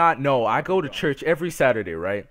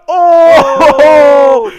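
very loud, high, long-held whoop; imitated crowd "oh"; 11 semitones higher, 16 dB louder than usual. Imitating everybody reacting to the pickup, hammed-up crowd holler. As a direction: hoot it like a roomful of onlookers. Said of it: "Everybody in the room's like".

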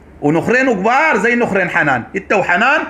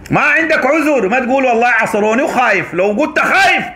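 high, raised, accusing voice; angry denunciation; 7 semitones higher than usual. Real, not acted. Denouncing the sheikh to his face over forbidden money, angry raised voice. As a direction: hammer each charge at him, pitched high.

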